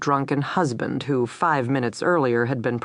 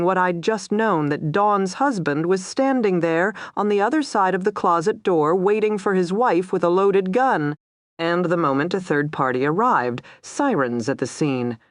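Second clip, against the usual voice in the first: plain reading voice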